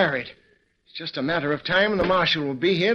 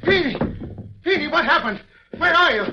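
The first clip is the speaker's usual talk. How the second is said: alarmed shouting for Feeney; frightened calling; 9 semitones higher than usual. Acted. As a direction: call out for your man in panic. Searching for him in the dark.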